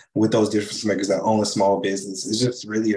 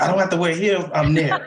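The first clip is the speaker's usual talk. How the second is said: high-pitched, lightly voiced women's reaction; mock-excited women, pitched up; imitating delighted women; 6 semitones higher, 3 dB louder than usual. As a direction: quote them with eager, raised pitch. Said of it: "the feedback from the women".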